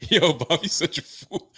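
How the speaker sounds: cracking up mid-sentence; amused disbelief at his friend; laughing. Real, not acted. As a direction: laugh through the words, high and loud. Laughing at co-host's take.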